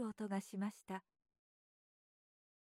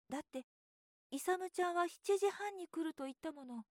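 put-on higher boyish voice, worried; a child regretting his promise; fretting little boy; 6 semitones higher, 4 dB quieter than usual. A narrator's reading. Boy frets aloud about his fishing promise, higher and worried.

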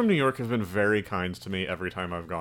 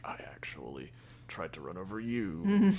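soft breathy whisper of a movie line; eerie whisper; 6 dB quieter than usual. Retold or imitated. Retelling the beach scene, whispered. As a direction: whisper it like a quietly unhinged woman. Movie ending quote retold.

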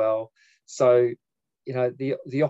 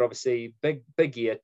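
normal talking voice

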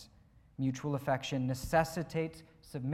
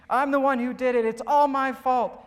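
high, loud, overwrought cartoon confession; Junior; 7 semitones higher, 8 dB louder than usual. Pastor voicing cute little Junior confessing, loudly worked up. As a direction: take the blame at full mock distress. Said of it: "worked up".

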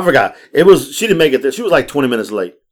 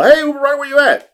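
high-pitched mock caller hailing the driver; imitated caller; 14 semitones higher, 4 dB louder than usual. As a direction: put on a high voice, calling out to find your ride. Caller hunting for the Uber driver.

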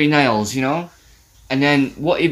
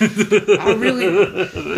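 slow, laughing, pitched up; laughing through words; 5 semitones higher, much slower than usual. Real, not acted. Co-host laughing as she admits disliking Daphne. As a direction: talk through the giggle.

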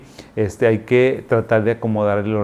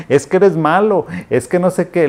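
put-on higher and louder, a playful child's complaint; mock whiny daughter; 8 semitones higher, 5 dB louder than usual. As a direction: whine it like a teasing little girl. Daughter teasing, entre broma y broma. (hija quejándose en broma, voz más aguda y fuerte)

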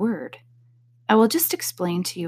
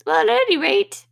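squeaky Dormouse; 14 semitones higher, 3 dB louder than usual. A narrator's reading. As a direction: squeak it out like a tiny mouse. Dormouse voiced in story.

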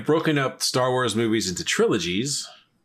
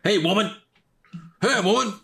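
tough guy taunt; 9 semitones higher than usual. Retold or imitated. Quoting Clubber Lang from Rocky III.

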